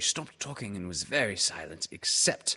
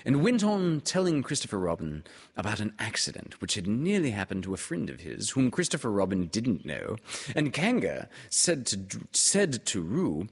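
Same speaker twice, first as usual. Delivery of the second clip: plain reading voice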